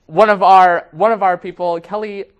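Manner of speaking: loud, lively telethon host. Pretend. Hosts a telethon segment.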